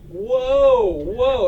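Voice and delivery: frantic shout to a runaway horse; panicked whoa. Acted. Panicked rider calling to horse.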